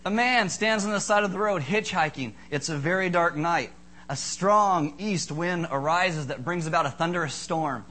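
storyteller voice, building suspense; preacher spinning a Halloween ghost story; spooky story telling. Acted. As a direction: tell a ghost tale to the room. Halloween ghost story told to congregation.

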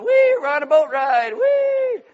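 high, loud gleeful squeal; mock childlike glee on a boat ride; happy cartoon giraffes. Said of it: "giraffes and their heads hanging out the window going".

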